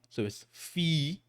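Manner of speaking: fi with a long E, slow. Shown as a demonstration. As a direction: draw out the vowel in fi. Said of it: "There's a fa with an e sound".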